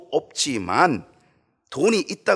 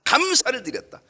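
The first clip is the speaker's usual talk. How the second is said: 감사를 드렸다 said much higher and louder, falling at the end; second 감사를 드렸다 ringing out after the whisper; proclaimed; 10 semitones higher, 4 dB louder than usual. Shown as a demonstration. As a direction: call out 감사를 드렸다 high and strong. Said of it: "repeats the phrase two ways".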